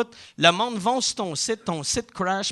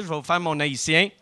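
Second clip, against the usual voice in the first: normal talking voice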